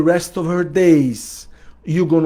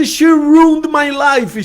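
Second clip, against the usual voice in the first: higher, louder, slow mock of the woman's outburst; retold upset woman; 10 semitones higher, 5 dB louder, slower than usual. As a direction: voice her hurt, high and drawn out. Woman voiced in retold session.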